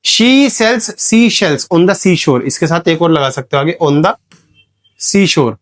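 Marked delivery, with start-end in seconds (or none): 0.0-2.4 every word drawn out very slowly; teacher reads the tongue twister very slowly for the class; slow example read. Shown as a demonstration. Teacher stretching each word slowly for students. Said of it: "जैसे".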